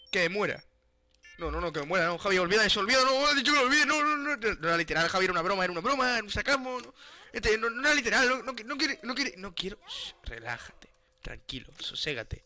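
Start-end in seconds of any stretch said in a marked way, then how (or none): 2.8-4.7 high, loud, tumbling words; panicked retraction. Real, not acted. Player retracting his oath to Javi, panicked insistence. 9.9-12.4 low, soft, slow shushing; hushed soothing. Real, not acted. Player settling Javi down, hushed soothing.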